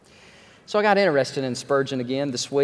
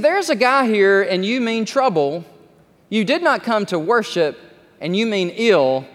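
preacher's warning; 6 semitones higher, 5 dB louder than usual. Retold or imitated. Spurgeon warns the gin-carrying man in the gallery, loud and high. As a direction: call the man out from the pulpit. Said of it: "He said".

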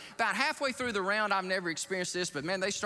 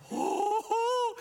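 high, louder gasp of wonder; awed ooh; 4 dB louder than usual. Retold or imitated. Pastor looking back as the jet goes vertical, awed. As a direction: marvel at the view as the jet climbs. Awe at leaving Earth.

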